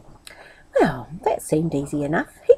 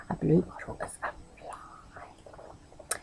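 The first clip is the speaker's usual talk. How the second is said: whispered aside; 3 semitones lower, 17 dB quieter, slower than usual. A narrator's reading. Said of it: "whispered aside explaining to listeners".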